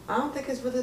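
ordinary talk

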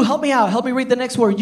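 normal talking voice

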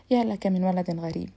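ordinary delivery, nothing marked

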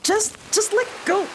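high, desperate plea to let go; pleading. Acted. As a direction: beg her to let go, voice strained. Dragged player begs goblin.

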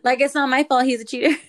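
loud, laughing self-justification; loud indignant outburst, half laughing; loud defiance. Real, not acted. Justifying breaking his window.

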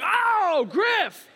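high, loud exasperated Oh Griff; a big mock-dismayed family cry; exasperated groan. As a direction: groan at him like a fed-up sibling.

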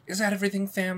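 put-on high, questioning voice; high waitress voice. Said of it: "have that high of a voice".

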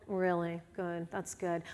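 soft, dry sarcastic approval; preacher answering the introvert excuse, sarcastic; mock approval. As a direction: say it drily, not buying it.

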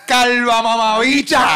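high, loud laughing yell; playful shouted insult; mock insult shout. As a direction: fire back with a jeer.

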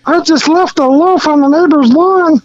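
high, chirpy little elf; squeaky holiday elf greeting; Christmas elf voice. Retold or imitated. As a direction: play a cheery Christmas elf. Elf confesses prank.

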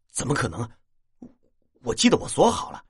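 带着不敢相信的惊讶; 难以置信地辩解，越说音调越高; 惊讶叫道. A narrator's reading. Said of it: "目瞪口呆，叫道". (目瞪口呆地叫道)

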